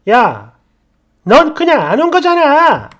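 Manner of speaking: shouting angrily at a friend on the phone. Retold or imitated. Yelling at the friend who skipped the wedding, angry shout. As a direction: snap it out loud and high, fed up. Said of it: "좀 언성을 높였더니".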